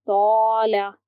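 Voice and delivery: तौला with a full, heavy "tau", mouth filled. Shown as a demonstration. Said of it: "इस तरीके से पढ़ेंगे".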